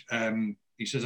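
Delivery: ordinary delivery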